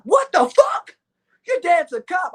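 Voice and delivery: high, loud shocked outburst; freaking out at the girlfriend's news. Retold or imitated. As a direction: blurt it out in disbelief.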